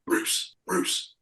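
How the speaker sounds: breathy hushed whisper of the name; urgent whisper. Retold or imitated. Fourteen-year-old daughter tipping off the narrator, urgent whisper. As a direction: whisper so the star won't hear. Teen girl alerting them to Bruce.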